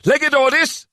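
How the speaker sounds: high calling shout. A narrator's reading. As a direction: call him across the room. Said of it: "Sajay shouted".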